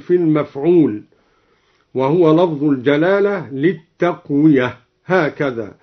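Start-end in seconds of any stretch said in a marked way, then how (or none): none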